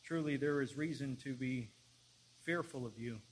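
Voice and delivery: measured prayer, long pauses between phrases; solemn public prayer. Real, not acted. Preacher leading the church in prayer to God, solemn public prayer. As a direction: address God reverently in firm, spaced-out phrases.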